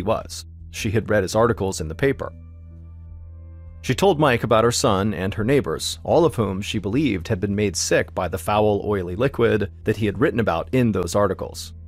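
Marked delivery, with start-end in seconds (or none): none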